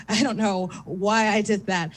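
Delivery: normal talking voice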